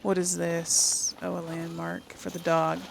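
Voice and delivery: ordinary talk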